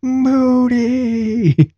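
very high, long-held holler; imitated crowd shout. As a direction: shout it back like a whole crowd. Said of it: "thirty local nationals go".

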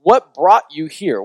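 earnest, pointed question; for real. Shown as a demonstration. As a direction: ask it in earnest, straight at the listeners. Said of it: "But also, for real".

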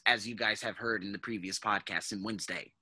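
ordinary delivery

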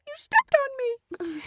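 high squeaky complaint; little Andy voice. Pretend. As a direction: whine like a squashed toy. Puppet complains after being stepped on.

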